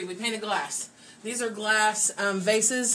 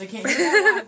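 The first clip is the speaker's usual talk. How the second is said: higher, louder, laughing disbelief; surprised laugh; 6 semitones higher, 7 dB louder than usual. Real, not acted. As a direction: caught off guard, laughing. Surprised nobody online hears them.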